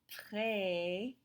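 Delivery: préférer slowly, syllable by syllable, each E as "ay"; French é sound. Shown as a demonstration. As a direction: draw out préférer with French é vowels. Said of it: "think about that E sound when I say".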